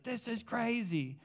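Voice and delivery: high, drawn-out mock amazement; amazed. Buddy hears what happened in the room, amazed. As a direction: stretch it out high in astonishment.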